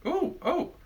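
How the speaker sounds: sudden high delighted gasp; surprised. Real, not acted. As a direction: caught off guard by the smell. Reacts to first sniff.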